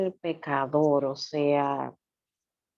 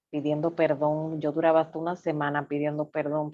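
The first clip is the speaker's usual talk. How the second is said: ordinary talk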